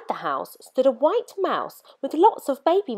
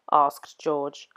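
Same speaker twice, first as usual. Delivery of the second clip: ordinary delivery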